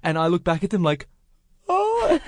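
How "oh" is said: stunned high oh; high deflated oh. Retold or imitated. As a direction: let it drop in disbelief. Sees date making out.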